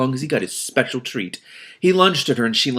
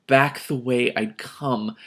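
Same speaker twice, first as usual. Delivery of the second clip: ordinary delivery, nothing marked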